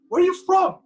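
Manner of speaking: mock drill shout.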